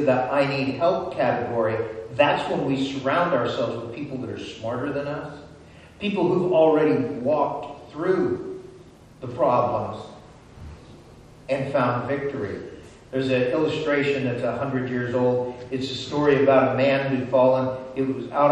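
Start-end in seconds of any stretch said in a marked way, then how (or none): none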